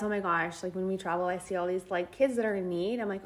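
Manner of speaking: normal talking voice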